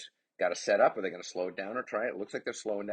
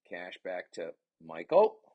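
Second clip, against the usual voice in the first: ordinary talk, nothing marked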